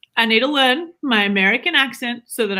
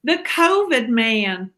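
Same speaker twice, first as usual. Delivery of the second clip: slow American accent slipping into Southern; attempted American accent; much slower than usual. Shown as a demonstration. Wife attempts American accent, comes out Southern. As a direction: aim for generic American, land on a Southern drawl. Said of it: "give me, like, generic American".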